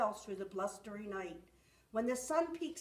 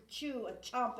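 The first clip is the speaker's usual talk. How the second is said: ordinary delivery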